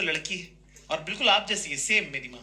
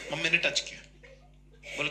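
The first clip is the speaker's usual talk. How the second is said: ordinary talk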